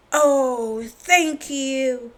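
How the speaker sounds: a hint of a grateful farmer. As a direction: thank him warmly. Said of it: "said Farmer Fields".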